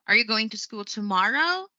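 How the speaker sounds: yes-no question tune, lifting on "tomorrow"; rising intonation. Shown as a demonstration. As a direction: voice rises steadily on "tomorrow". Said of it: "the rise of my voice was done at the end".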